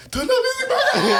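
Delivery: squeaky high laughing demand; cheeky party shout. Retold or imitated. Wishing she'd told the party to crank the music, giddy high squeal. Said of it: "I just want to be like".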